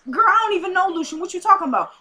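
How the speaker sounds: high, quick mock indignation; indignant denial. [name] denying she knows Lucian, acted indignant. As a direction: deny it fast, pitch jumping up.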